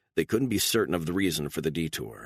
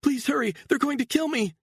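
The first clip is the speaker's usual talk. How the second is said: high, frantic woman's plea; frantic fake 911 call; 3 dB louder than usual. A narrator's reading. Actress faking panic to the emergency operator, high and frantic. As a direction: act terrified for the operator. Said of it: "Beatrice shouted it into the phone".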